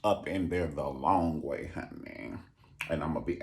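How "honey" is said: whispered aside. Acted.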